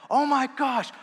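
loud, high-pitched gushing outburst; imitated ecstatic raving, way up high and loud; freaking out. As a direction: freak out over a favorite song, imitating a fan. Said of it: "He's just freaking out".

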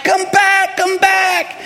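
high shout after someone running off; retold calling out. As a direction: re-enact hollering to someone far away.

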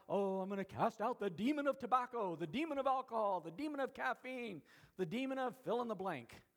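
hammed-up, high-pitched zealous demon caster; loud parody of an overeager deliverance preacher; mock exorcist. Mocking over-eager demon hunters casting out.

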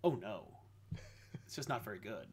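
low, hushed disappointment; quiet dismay. Retold or imitated. Podcaster retelling watching a weak show, quietly let down. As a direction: drop the voice into a soft, sinking aside.

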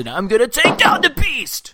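high, loud nasally wrestler promo; Seth Rollins impression. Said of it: "I'm gonna talk like this".

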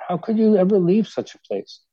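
high, disbelieving question; astonished. Acted. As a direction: ask in wide-eyed disbelief. Snowman asks in disbelief.

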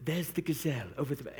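lightly imitated soothing documentary narrator; a brief, hushed attempt at a soothing nature voiceover; David Attenborough. Pastor imitating his favorite nature-film narrator for laughs, soothing. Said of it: "Most soothing voice".

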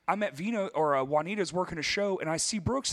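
ordinary delivery

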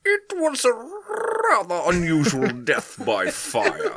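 slow, high attempt at a Welsh accent; a bad, laughing try at a Welsh accent. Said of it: "I don't know how to do a Welsh accent".

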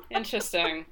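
ordinary delivery

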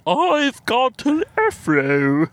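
slow, high, stretched-out silly voice; Michael impression. Podcaster keeping up the act for co-host, high impression voice. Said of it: "do a Michael impression".

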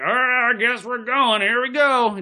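high, slow, dragging mock groan; groggy hungover voice. Friend mocking a hungover driver starting the trip, high and dragged out. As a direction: play someone wrecked from last night, setting off.

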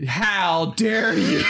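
high, slow theatrical indignation; mock outrage. Defending Kevin Costner from co-host, hammed-up mock outrage. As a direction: cry out in hammed-up offence.

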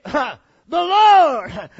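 slow, high, loud gasp of awe; awed shout. Acted. The people on their faces, crying out in loud awe. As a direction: cry it out as the crowd falls down.

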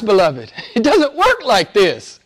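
normal talking voice

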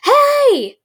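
high startled yelp; indignant cry. Acted. Girl yelps at her twin popping her bubble gum. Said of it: "Chris cried".

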